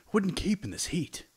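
a fussy traveler asking for butter; character voice. Acted. GM voices Orvex.

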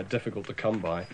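usual speaking voice

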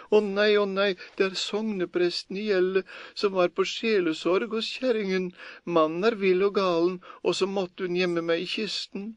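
high, desperate begging; priest pleading. A narrator's reading. Priest in chest bargains with Per, desperate pleading. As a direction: plead frantically to be let out. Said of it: "ropte presten".

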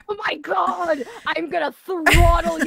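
loud, high, playful fury; exasperated mock threat.

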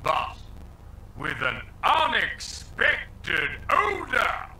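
slow, wailing mock-tragic shout; dramatic shout. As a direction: drag it out like a melodrama. Dramatic game narration reveal.

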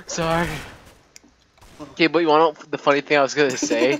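ordinary talk, nothing marked

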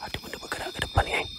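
hushed, fast whisper. Real, not acted. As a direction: breathe the words out, scared to be heard. Ghost hunt, noticing something move. (berbisik laju)

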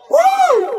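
very high-pitched party "woo"; high whoop. Pretend. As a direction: whoop into the microphone. Hyping on the mic.

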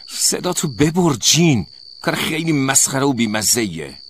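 loud, high, furious yelling; angry shout. A narrator's reading. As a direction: yell at your roommate to shut up. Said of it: "سوزانا عصبانی شد. داد زد و گفت".

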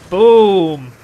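loud, long-held boom; booming hit call. Real, not acted. Gamer as he rams someone, loud drawn-out boom. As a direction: punch it out and hold it.

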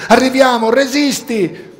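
high, loud call down into the rubble; encouraging shout. A narrator's reading. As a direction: yell hope to someone buried. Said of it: "gridando intanto a turno".

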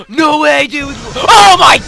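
shocked cry, high and loud; startled shout. Real, not acted. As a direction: yelp in real fright. Sudden scare in horror game.

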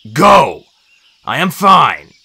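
loud, firm command; insistent order. A narrator's reading. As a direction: send them off forcefully. Chiron orders helpers away.

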